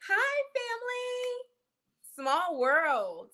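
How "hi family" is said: much higher, slow, stretched hello; high sing-song greeting. Real, not acted. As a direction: croon it like greeting a relative.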